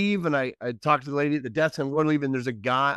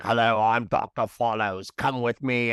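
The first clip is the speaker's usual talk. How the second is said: lightly voiced movie villain; a hint of a Bond villain; Bond villain doctor; 3 semitones lower than usual. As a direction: imitate an ominous doctor, understated. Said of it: "he looked like a villain from a Bond movie".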